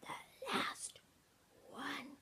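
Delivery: hushed, word by word; very slow and quiet. A narrator's reading. Daddy Bear warns Ben, very slowly and quietly. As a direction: space out each word, barely voiced. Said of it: "said Daddy Bear very slowly and very quietly".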